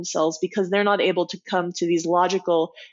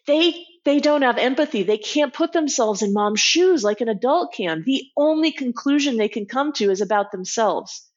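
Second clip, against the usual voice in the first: ordinary talk, nothing marked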